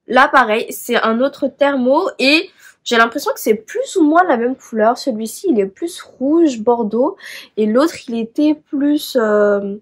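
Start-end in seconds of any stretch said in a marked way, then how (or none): none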